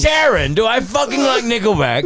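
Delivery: loud, very high British rocker calling out. Acted. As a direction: yell to the wife like a baffled old rock star. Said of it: "actually met [name]".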